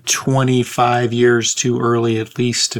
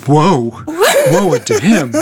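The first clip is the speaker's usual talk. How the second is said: laughing, booming woe unto him; mock biblical prophet; 5 semitones higher, 6 dB louder than usual. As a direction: ham up the prophet's curse. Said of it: "throwing out the whoa woes and the brimstone".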